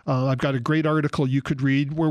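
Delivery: ordinary delivery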